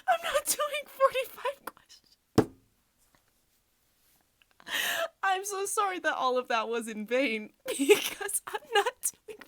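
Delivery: very high, loud, near-tearful laughing squeal; helpless laughing. Real, not acted. Apologizing to viewers for abandoning the quiz, helpless laughing. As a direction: wail it out, losing control to laughter.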